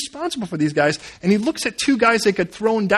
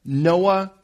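ordinary talk

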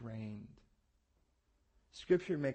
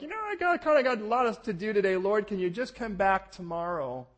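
higher, louder casual excuse to God; mock lazy brush-off; 8 semitones higher, 8 dB louder than usual. Preacher imitating himself putting off God, mock casual. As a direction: shrug off the Lord playfully. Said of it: "Those days where I'm like".

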